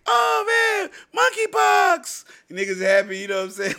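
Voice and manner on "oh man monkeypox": very high, loud, slow shout of joy; thrilled kid shout. Pretend. Kid cheering for monkeypox cereal, high loud shout. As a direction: scream it like a kid seeing cereal.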